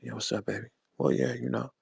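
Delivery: soft, murmured smooth-talker imitation; mock his hushed, dropped come-on; put-on cool, quiet phone voice; Mickey's voice around girls. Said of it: "But in front of the girls, it was".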